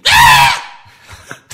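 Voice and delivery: loud high squealing creature cry; creature shriek. Acted. Game master voicing the creature's scream from the trees. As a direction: blast out a wild animal screech.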